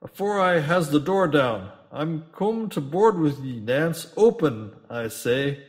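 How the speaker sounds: loud, demanding to be let in; captain shouting at Nance through the door. Acted. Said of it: "shouted the captain".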